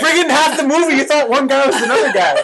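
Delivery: high, loud laughing tease; mock the friend through laughter; laughing teasing. Real, not acted.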